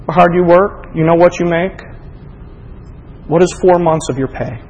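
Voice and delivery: normal talking voice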